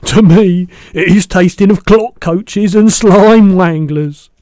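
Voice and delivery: very loud, high, slow giant; roaring BFG. A narrator's reading. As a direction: boom it out with relish, drawing out words. Giant roars about the taste.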